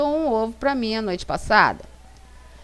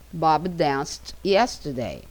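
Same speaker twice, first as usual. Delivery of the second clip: slow model sentence; 3 semitones lower, much slower than usual. Shown as a demonstration. Teacher models example sentence.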